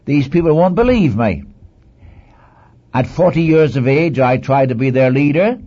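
acted objection, pushing the excuses; Moses making excuses. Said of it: "So he tries to hide behind".

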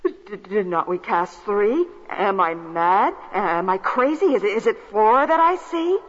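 stammering, bewildered king. Acted. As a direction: stutter in disbelief. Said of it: "He stammered and he stuttered".

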